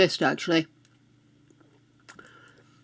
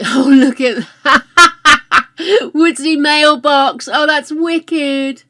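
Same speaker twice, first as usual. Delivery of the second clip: giggling, high and loud with delight; laughing delight; 8 semitones higher, 9 dB louder, slower than usual. Real, not acted. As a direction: laugh through the words, thrilled. Laughing delight at Sims item.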